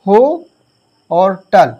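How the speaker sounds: "हो" rising and held apart, then "टल"; split into syllables. Shown as a demonstration. Teacher divides hotel for students, syllable by syllable, "हो", "टल". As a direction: break the word into "हो" and "टल" with pauses. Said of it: "जैसे हमने लिखा इसको पढ़ा".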